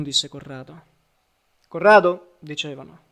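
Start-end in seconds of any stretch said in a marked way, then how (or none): 1.7-2.4 loud, raised call of a name; much louder, as if to someone. A narrator's reading. Several people calling a name.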